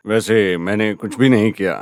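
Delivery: low, theatrical line reading; stage-dialogue voice. Acted. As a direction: drop the pitch as if reciting a play. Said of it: "मैं नाटक के dialogue बोलने लगा था". (नाटक के डायलॉग जैसी नीची आवाज़)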